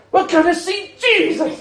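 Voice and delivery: high thrilled cry; excited. Retold or imitated. Wife in a car crash, thrilled high exclamation. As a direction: shout it with pure joy. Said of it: "Georgie was super excited".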